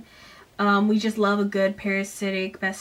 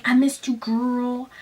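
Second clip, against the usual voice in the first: Cam's line; 3 semitones higher, slower than usual. Retold or imitated. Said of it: "and Cam is like".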